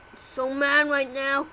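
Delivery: ordinary delivery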